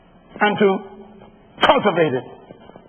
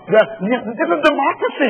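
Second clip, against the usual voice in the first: usual speaking voice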